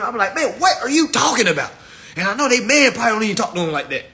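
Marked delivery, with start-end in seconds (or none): 0.3-1.7 loud, high exasperated challenge; heated, punchy retort; getting loud. Retold or imitated. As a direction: raise your voice as if telling someone off. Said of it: "I be getting loud with these women".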